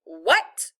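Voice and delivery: sudden loud, sharp challenge; explosive Southern grandma retort; popped like a snapped bag. A narrator's reading. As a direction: snap it out in one loud burst. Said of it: "Grandma Sands' voice popped like one of those big brown grocery bags being snapped open".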